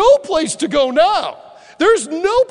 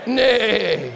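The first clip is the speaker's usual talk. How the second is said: lightly voiced donkey saying "Nay, nay"; mock donkey "nay"; 5 semitones lower than usual. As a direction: say "Nay, nay" a bit like a donkey bray, laughing. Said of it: "sounding just like the donkey".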